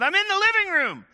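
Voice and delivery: high, loud holler from another room; yelling back. Retold or imitated. Man answering unseen visitors, loud high yell. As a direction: holler it across the house.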